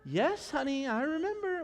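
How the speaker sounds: high, put-upon reply to a nagging partner; exasperated spouse. Acted. Acted spouse answering a nagging reminder.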